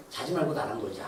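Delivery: low, soft tempting whisper of the devil; devil's coaxing voice. Retold or imitated. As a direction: coax like a devil luring you to stay up. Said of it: "막 이러면서". (낮고 작은 마귀의 유혹하는 목소리)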